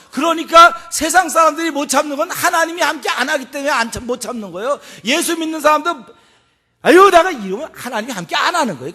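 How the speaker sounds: emphatic, high-energy preaching; preaching at full force. Real, not acted. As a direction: drive the point home to the congregation.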